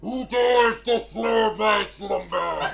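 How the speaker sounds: slow, booming voice of God; angry god voice. Acted. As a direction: rumble it out like a disturbed deity. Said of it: "Sounds mighty pissed".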